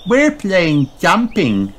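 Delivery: slow, high piglet voice; eager child, drawn out and high; Peppa. A narrator's reading. Peppa greets Rebecca Rabbit, high-voiced. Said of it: "says Peppa".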